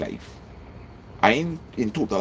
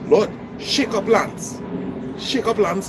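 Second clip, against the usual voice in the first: demanding prayer; 9 semitones higher, slower than usual. Shown as a demonstration. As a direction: order God to act, slow and forceful. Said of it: "Look at the difference".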